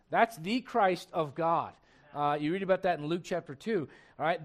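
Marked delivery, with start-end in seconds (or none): 0.1-1.7 slow, with "the" said as "thee"; emphasize "the" deliberately as "thee"; stress on THE. Shown as a demonstration. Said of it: "That's a definite article".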